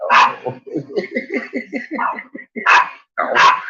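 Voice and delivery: ordinary talk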